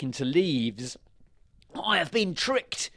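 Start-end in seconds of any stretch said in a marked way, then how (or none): 1.7-2.9 high, slow cry of outrage; baron's outraged shout. A narrator's reading. Baron finding only leaves, outraged shout. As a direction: cry out at being cheated. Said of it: "he shouted".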